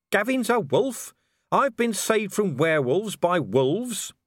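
disbelief, drawn out; incredulous. Acted. Vimes incredulous.

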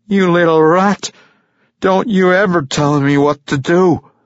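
put-on loud, high enraged drunk; furious howl. A narrator's reading. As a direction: scream it in rage. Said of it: "howling at him".

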